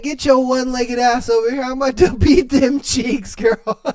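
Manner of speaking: hammed-up lover calling her over, laughing; mock boyfriend line, laughing through it; boyfriend quote. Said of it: "He said".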